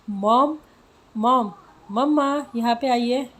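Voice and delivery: loud, high call to mom in the kitchen. Acted. Daughter calls mom loudly from another room. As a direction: shout for mom from the bedroom. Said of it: "इतनी क्यों चिल्ला रही हो".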